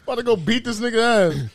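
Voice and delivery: mildly mocking angry-dad imitation; dad spazzing. Dad heading for the door, imitated fuming. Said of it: "my dad spazzes".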